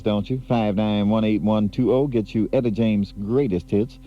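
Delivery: normal talking voice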